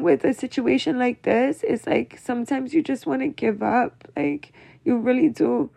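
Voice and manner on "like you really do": normal talking voice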